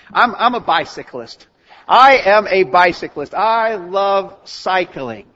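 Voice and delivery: proud insistence, drawing out "love cycling" slowly; emphatic, spaced-out claim; emphatic declaration. Acted. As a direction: proclaim it loudly, word by word.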